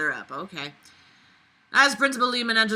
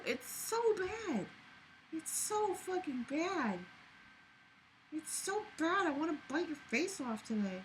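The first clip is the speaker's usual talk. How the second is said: pet talk; 9 semitones higher, 12 dB quieter than usual. Real, not acted. Owner coos baby talk at her pet about biting its face. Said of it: "doesn't everybody say stupid shit to their pets?".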